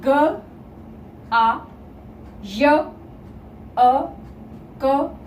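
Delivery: गायक split into ग, आ, य, अ, क with long gaps; teacher slowly dictating separate letter sounds; sound-by-sound breakdown. Shown as a demonstration. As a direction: say each sound of गायक alone. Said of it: "वर्ण विच्छेद करके देख लिया".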